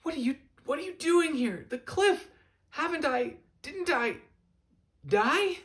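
put-on high, rattled young voice tumbling over itself; a hint of a frantic young bird; young gull Fletcher, panicked. Fletcher, confused after the cliff, asking Jonathan in alarm.

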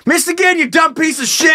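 high, hollered comic insult; mock taunting shout. Target insults the missing shooter, mock taunting shout. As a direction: heckle at the top of your voice.

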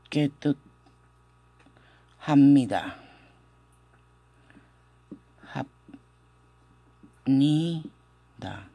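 broken into syllables, long gaps between; slow pronunciation demo. Shown as a demonstration. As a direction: coach a hard word piece by piece. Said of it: "Esta pronunciación es un poco difícil". (despacio, separando cada sílaba)

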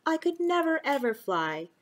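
ordinary delivery

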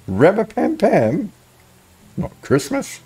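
puzzled high question. Acted.

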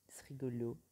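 murmure sans voix, amusé; souffle-le comme une confidence amusée; chuchoté. Pretend. Chuchoté après le rire. (chuchoté, amusé)

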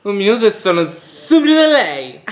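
high, loud laughing quote; catchphrase voice. Retold or imitated. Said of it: "chi era poi che diceva così?".

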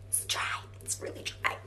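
hushed whisper behind her back. Acted. Hostess whispering that Jessica's turkey is dry. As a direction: whisper it so nobody hears.

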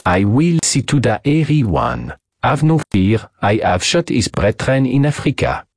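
put-on confident French-accented English; French accent, assured and in command; French hunter, reassuring. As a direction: promise protection as a seasoned French hunter.

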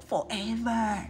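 understated heaviness; heavy, weary. Acted. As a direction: say it with a weight on it. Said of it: "said Duck heavily".

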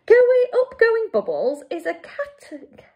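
high, slow giant's outcry; exclaimed protest. A narrator's reading. Giant objects to fizzy bubbles, slow high outcry. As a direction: cry it out, drawn out and high. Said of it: "cried the B F G".

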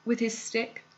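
plain reading voice

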